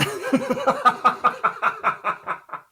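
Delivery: high, long helpless laugh; big laugh. Real, not acted. Cracking up at the co-host's remark, big high laugh. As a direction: crack up mid-sentence. Co-host cracks up mid-sentence.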